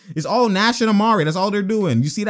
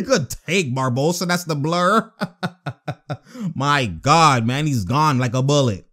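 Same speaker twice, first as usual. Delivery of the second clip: ordinary talk, nothing marked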